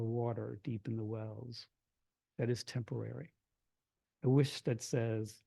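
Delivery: low-key, measured poem reading with pauses; poetry reading. Acted. Poet reading to a hushed audience, quiet measured verse. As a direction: read the poem softly, phrase by phrase.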